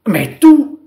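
high, loud burst of a reply; exclaiming. A narrator's reading. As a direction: cry it out as the indignant king. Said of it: "s'écria le roi".

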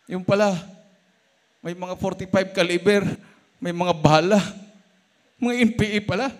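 loud, high, driving sermon voice; fiery preaching. Acted. Preacher stirring the church with crusade testimony, loud and high. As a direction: preach it out to the congregation.

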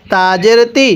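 normal talking voice

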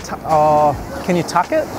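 normal talking voice

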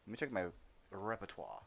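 ordinary talk

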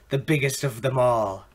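ordinary talk

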